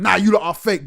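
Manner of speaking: imitated dismissive jeer; retold heckling. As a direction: call them out like a heckler. Voicing a hostile doubter of the rappers.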